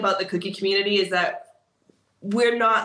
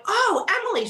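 much higher, sing-song hello; delighted greeting. Retold or imitated. Greeting her student at the contest, much higher delighted call. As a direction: greet her like a long-lost friend.

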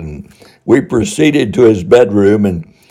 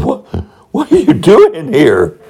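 startled; 7 semitones higher than usual. Retold or imitated. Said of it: "he stammered".

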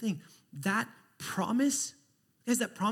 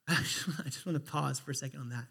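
ordinary talk

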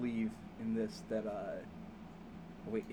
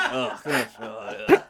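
weary groaning; 9 dB louder than usual. Retold or imitated. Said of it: "they're like".

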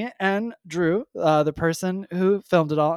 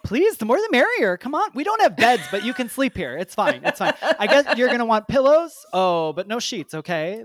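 overeager host waving guests in; mock eager host; 5 semitones higher than usual. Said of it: "those people are always like".

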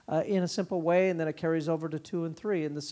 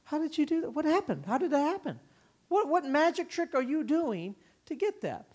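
higher, wondering questions; amazed parents; 9 semitones higher than usual. Retold or imitated. Other parents at the store asking in amazement. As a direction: marvel like an astonished onlooker.

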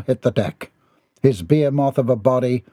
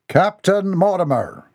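higher, drawn-out summons; commanding leader; 8 semitones higher than usual. A narrator's reading. Clan leader summons his captain, grand high call. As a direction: call out slowly with ceremony.

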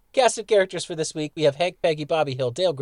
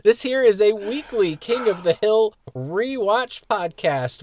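higher, slow hammed-up show intro; squirrely intro; 6 semitones higher, 4 dB louder, slower than usual. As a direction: announce the show like a big-voice host. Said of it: "why I did this intro this way today".

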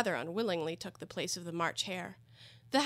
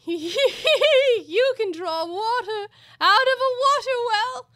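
high, loud, drawn-out cackling voice; gleeful laughing taunt at full volume; manic Hatter; 13 dB louder, much slower than usual. Acted. Hatter corrects Alice, with manic laughing glee.

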